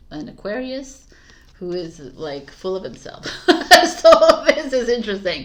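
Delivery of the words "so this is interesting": laughing. Real, not acted. Laughing about her twin.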